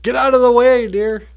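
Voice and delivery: high, loud shout of alarm; mock driver's yell. Driver trying not to slide yells at deer, mock alarm. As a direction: yell it like a panicked driver, hammed up.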